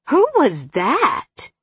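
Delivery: high, slow, swooping astonishment; surprised mother. A narrator's reading. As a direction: draw it out, agog at what was overheard. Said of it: "could hear her mother's surprise".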